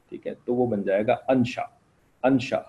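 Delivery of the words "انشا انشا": انشا said "an-sha", opening on a; zabar on alif. Shown as a demonstration. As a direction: demonstrate انشا with a short a on the alif. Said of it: "الف کے اوپر زبر لگا دیں گے".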